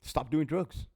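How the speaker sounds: hammed-up scolding of an overdosed inmate; mock scolding shout.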